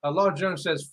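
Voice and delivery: usual speaking voice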